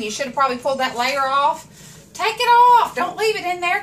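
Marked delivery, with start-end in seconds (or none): 2.1-3.8 high, loud, punchy instruction; suddenly raised, urgent-sounding order; emphatic command. Real, not acted. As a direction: call it out insistently. Emphatic instruction about onion layer.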